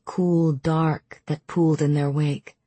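ordinary delivery, nothing marked

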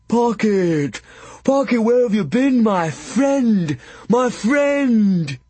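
high, loud, overjoyed simple giant calling out; a big simple man bellowing his delight, pitched high. Acted. Giant Drool calling across the drawbridge to his friend, high and loud. Drool runs to greet Pocket.

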